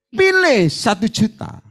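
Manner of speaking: slow, weighty command. Retold or imitated. Pastor voicing God's choice to the congregant, slow and weighty. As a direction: lay down God's choice slowly.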